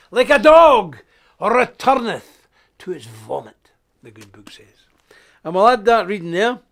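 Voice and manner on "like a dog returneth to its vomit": loud scripture quote. Acted. Quoting the good book.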